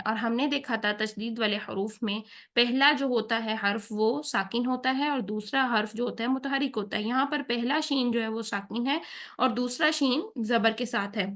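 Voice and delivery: ordinary talk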